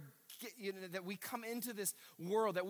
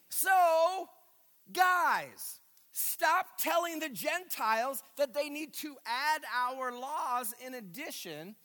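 louder, higher, emphatic plea as the apostle; Paul exhorting; 4 semitones higher, 5 dB louder, slower than usual. Acted. Preacher as Paul tells believers to stop, loud and emphatic. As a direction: preach it as an exasperated correction. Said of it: "That's how Paul starts his letter".

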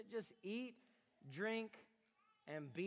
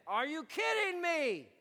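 exasperated; 6 semitones higher than usual. Retold or imitated. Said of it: "he says".

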